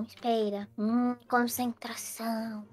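hushed whisper, barely voiced; whispering to focus before a wish. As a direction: whisper to keep the room silent. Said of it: "Não faça nenhum barulho".

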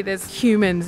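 usual speaking voice

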